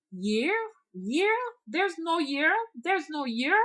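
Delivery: high, loud, puzzled repeats; her odd way of talking. Retold or imitated. Hunchback woman baffled by a question about the year. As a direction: echo the word back, baffled and sing-song. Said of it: "She talked like that".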